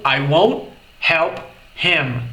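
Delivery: slow, drawn-out model of "won't"; slow down so students catch "won't"; slow repetition. Shown as a demonstration.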